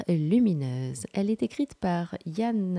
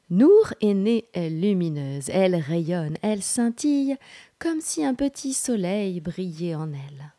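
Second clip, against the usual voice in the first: louder, expressive read-aloud with deliberate pauses; children's story narration; 4 semitones higher, 6 dB louder than usual. A narrator's reading. Host reading a picture book to children, in projected storytelling voice. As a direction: read it out vividly to young listeners.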